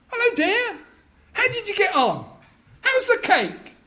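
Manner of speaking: high, sing-song returning wife; cheery wife. Acted. Wife home from the shop greeting husband, high and sweet. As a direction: breeze in sweetly. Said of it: "the front door opens".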